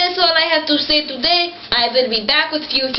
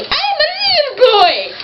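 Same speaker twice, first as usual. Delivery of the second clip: put-on much higher, chirpy wooden-boy voice; Pinocchio; 14 semitones higher than usual. Cow mimics Pinocchio in a high squeaky voice. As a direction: pipe it up like a puppet boy wishing to be real. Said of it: "like Pinocchio".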